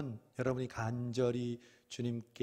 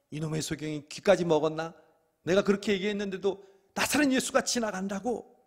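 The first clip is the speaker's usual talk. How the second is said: louder, higher scornful jeer; mocking scorn; 8 semitones higher, 8 dB louder than usual. Crowd jeering at Bartimaeus, louder and scornful. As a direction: sneer at the deaf blind man. Crowd mocks the blind man.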